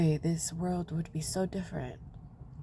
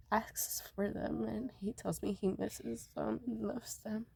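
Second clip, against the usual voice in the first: crying; 5 semitones higher, 4 dB quieter than usual. Real, not acted. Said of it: "Why are you crying, Mommy?".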